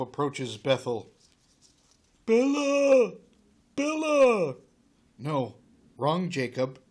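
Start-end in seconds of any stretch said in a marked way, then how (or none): 2.3-4.6 high, loud drawn-out calling; hammed-up high wail; mock lovelorn call. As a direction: call out for a lost love, falling at the end. Wrong Jacob calling for Bella.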